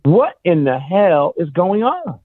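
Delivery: ordinary delivery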